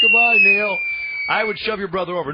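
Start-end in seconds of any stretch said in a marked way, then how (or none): 0.0-0.8 loud cheerful goodbye; gleeful farewell. Pretend. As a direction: wave him off with glee. Pushing Neil off a balloon.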